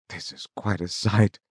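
ordinary delivery

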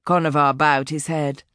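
ordinary delivery

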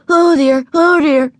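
high, loud in-and-out harmonica wheeze; harmonica imitation. A narrator's reading. Little girl puffs on her harmonica, noisy imitation. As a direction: sound it out like a harmonica. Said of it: "she inhaled and exhaled".

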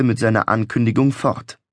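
usual speaking voice

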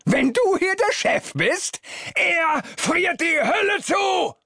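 very high-pitched enraged shouting; furious bellow. A narrator's reading. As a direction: roar it after someone storming out. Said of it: "brüllt ihm mein Vater hinterher".

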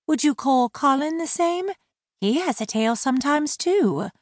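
high-voiced mermaid mocking his slur; wry mermaid. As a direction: let the sarcasm sit lightly. Laurel challenging.

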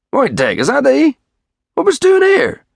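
very high, incredulous country voice; startled father demanding an explanation; astonished hail. Acted. Father finds his missing son.

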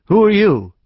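high, loud bratty challenge; boy confronting the stranger, belligerent; belligerent demand. A narrator's reading. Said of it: "he demanded belligerently".

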